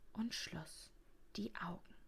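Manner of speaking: soft, whispery, trailing down; hushed bedtime narration. A narrator's reading. Narrator settling the guest to sleep, hushed.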